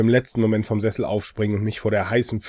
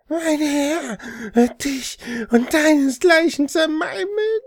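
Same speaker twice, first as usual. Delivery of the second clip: high, slow, wailing threat; demon voice; 4 dB louder, slower than usual. Acted. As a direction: wail it like a possessed demon.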